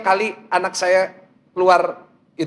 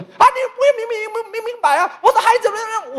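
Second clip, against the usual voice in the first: very high-pitched angry babble; hammed-up high shrieking rant; mock screaming angry woman; 13 semitones higher than usual. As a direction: imitate a furious woman yelling in gibberish. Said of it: "Wah, wah, teriak".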